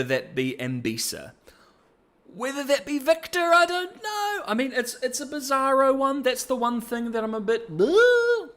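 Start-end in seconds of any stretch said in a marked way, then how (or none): 2.3-7.8 much higher, comically strained voice; high-pitched unease. Shown as a demonstration. Reviewer balking at the bizarro choice, high-pitched mock unease. 7.8-8.5 very high, loud noise of distaste; loud, high-pitched blurt of mock disgust; drawn-out "bleh". Shown as a demonstration. Reviewer unsure about the ending, loud high bleh.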